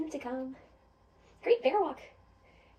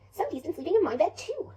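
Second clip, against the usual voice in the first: high, loud, lilting mother bear; Mama Bear; 5 semitones higher, 6 dB louder than usual. Acted. Mother bear seeing her bed was slept in, high and indignant. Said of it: "Mama Bear comes over".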